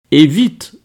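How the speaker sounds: high, loud, urgent add-on; pressing order. A narrator's reading. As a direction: hurry him along sharply. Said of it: "ajouta-t-elle pour l'achever".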